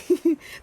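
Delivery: short, high burst of laughter. Real, not acted. Reader laughs at her own accent attempt, amused laugh.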